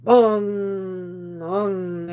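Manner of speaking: booming imitated bell toll, loud then fading; church bell bong. Imitating the church bell that woke her, booming bong. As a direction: ring it out like a giant church bell. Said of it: "it's like this".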